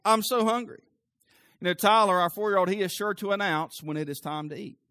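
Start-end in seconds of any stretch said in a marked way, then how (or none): 0.1-0.8 higher, mock-whiny little kid; put-on whine of a small boy; whiny child. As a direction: imitate a hungry child whining. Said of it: "because the whiny voice".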